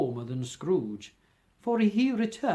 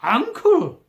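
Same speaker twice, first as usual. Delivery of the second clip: high, loud pleading call; 11 semitones higher, 10 dB louder than usual. Acted. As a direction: beg him to soften. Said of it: "pleaded the nephew".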